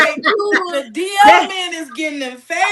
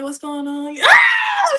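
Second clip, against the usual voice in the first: sensual voice demo; 10 semitones higher, much slower than usual. Shown as a demonstration. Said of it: "trying to get a little sensual".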